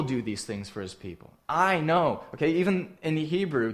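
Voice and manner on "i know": "I" hit hard, high and loud; stress on I. Shown as a demonstration. Preacher showing his congregation the emphatic "I", stress on I. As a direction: lean on the "I" in "I know". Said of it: "the word "I" is emphatic".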